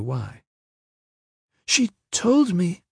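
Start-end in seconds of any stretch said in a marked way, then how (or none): 1.6-2.8 put-on very high, slow, fearful girl's voice; timid, drawn-out high voice; timorous servant girl. A narrator's reading. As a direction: speak up hesitantly as a frightened girl. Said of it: "the girl blanched".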